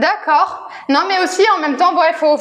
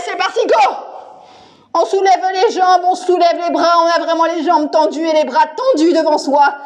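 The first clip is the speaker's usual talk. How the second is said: ordinary delivery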